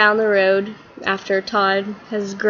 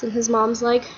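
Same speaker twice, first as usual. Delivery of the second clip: ordinary talk, nothing marked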